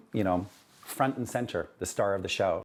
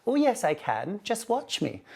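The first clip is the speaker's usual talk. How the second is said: imitating his mother's Scottish accent, pitched much higher; a son doing his mother's Scottish accent, much higher; mum's Scottish accent; 11 semitones higher than usual. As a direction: quote mum in her Scottish accent, up high. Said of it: "in her beautiful Scottish accent".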